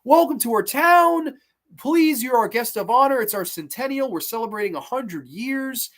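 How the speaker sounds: loud, high-pitched welcome from an overly friendly townsperson; hammed-up small-town hospitality, starting big and easing off; over-the-top nice. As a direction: gush an exaggerated welcome to visitors. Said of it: "just over-the-top nice".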